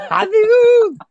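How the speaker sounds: loud, high, drawn-out scream; imitated fan scream. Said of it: "Ernie screamed out".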